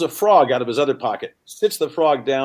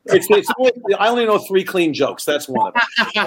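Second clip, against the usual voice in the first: laughing; 6 semitones higher, 4 dB louder than usual. Real, not acted. Still laughing after punchline.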